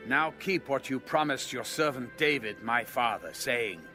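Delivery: ordinary delivery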